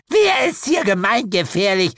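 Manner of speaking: high, loud witch's snap; indignant snapping. A narrator's reading. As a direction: snap back as if insulted. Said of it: "schnauzte mich Clotilde an". (empört angeschnauzt)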